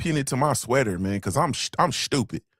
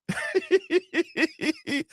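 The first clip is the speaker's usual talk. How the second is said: high-pitched giggling laugh. Real, not acted. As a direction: laugh in a high, squeaky run. Laughing at own joke.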